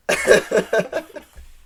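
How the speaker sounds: short high-pitched laugh. Real, not acted. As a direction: break into a squeaky laugh. Laughing at late night.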